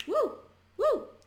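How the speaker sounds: high yelped "Uh!" from the song; high sung "Uh". Shown as a demonstration. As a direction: hit a high "Uh!" like the band. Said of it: "Tem uma música".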